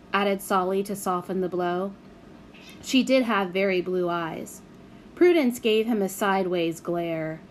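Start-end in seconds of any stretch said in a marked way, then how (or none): none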